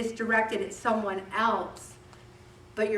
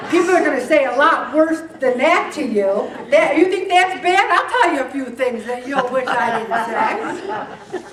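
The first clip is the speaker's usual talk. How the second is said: louder, higher talk through laughter; laughing; 4 semitones higher, 9 dB louder than usual. Real, not acted. Teasing listeners about harsh words, laughing loudly. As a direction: keep laughing as you speak.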